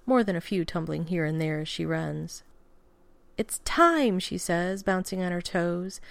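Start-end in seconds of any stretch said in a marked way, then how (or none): 3.4-4.2 put-on much higher, bright and eager little girl; excited child. A narrator's reading. Grace calling Danny to the wedding, higher excited child voice. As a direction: bounce on it with joy.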